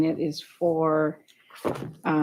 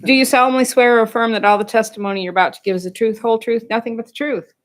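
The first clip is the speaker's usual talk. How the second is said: fast, loud oath read by rote; rote court oath; 3 semitones higher, 6 dB louder, faster than usual. Real, not acted. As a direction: rattle off the swearing-in oath. Hearing officer reads oath.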